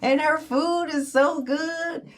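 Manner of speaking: high, gushing imitation of friends; high-pitched mock gush of admiring friends; gushing admirer. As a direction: squeal it like an excited fan of Mom. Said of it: "they always say".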